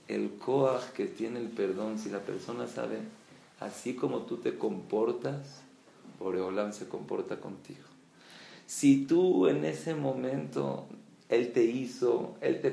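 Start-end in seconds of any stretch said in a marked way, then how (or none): none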